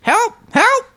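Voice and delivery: very high panicked bird voice. Acted. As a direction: squawk it like a frantic toucan, very high. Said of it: "Burp voice".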